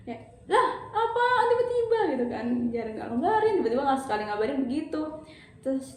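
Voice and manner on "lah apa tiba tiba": high, whiny disbelief; retold whiny complaint at boyfriend; exasperated whine. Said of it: "aku kesel kan".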